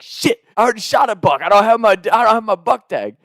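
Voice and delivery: high, flustered mock alarm; imitating panicked brother. Brother realizes he lacks a buck tag, panicked.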